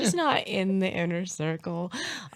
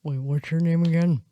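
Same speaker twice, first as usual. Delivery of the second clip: louder put-on dad voice; Harold; 4 dB louder than usual. Host plays her dad asking the other dad's name, put-on dad voice. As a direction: ask it as a gruffly puzzled older man. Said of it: "I should do Harold's voice".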